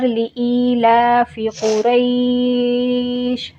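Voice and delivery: slow chant drawing कुरैश out for four counts; four-count stop. Shown as a demonstration. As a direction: stretch the vowel of कुरैश longer before stopping. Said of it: "चार हरकत खींचूँगी". (कुरैश पर चार हरकत खींचकर वक्फ)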